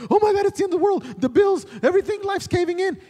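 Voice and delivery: husband mimics wife freaking out over bills, high and loud; mock panicked wife. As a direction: squeal it like the world is ending. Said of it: "She's, like, freaking out".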